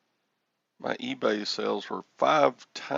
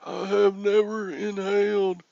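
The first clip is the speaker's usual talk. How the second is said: mock-solemn denial; 9 semitones higher, 4 dB louder than usual. Tells Tommy he never inhaled, high mock denial. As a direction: protest your innocence in a high, hammy voice.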